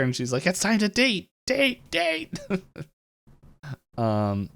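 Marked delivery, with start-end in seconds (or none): 0.5-2.4 very high, chanting insistence; hammed-up squeal, climbing on each repeat; mock manic urging. Acting out Mabel chasing women out.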